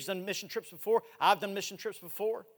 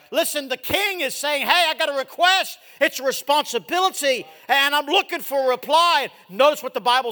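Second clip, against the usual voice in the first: loud, high, driving preacher voice; shouted sermon climb; full-force preaching; 9 semitones higher, 12 dB louder than usual. Acted. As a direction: preach it out hard to the congregation.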